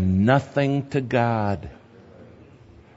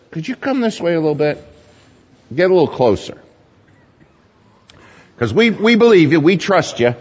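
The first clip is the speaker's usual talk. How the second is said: mock pleading prayer; 4 semitones higher than usual. Mock disciples' hedging prayer.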